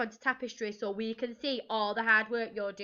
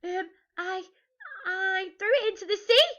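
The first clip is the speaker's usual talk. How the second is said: high, hesitant troll; sheepish, high-pitched admission; nervous confession; 9 semitones higher, 4 dB louder than usual. A narrator's reading. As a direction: stumble in, then rise to a squeak. Troll confessing to angry pirates.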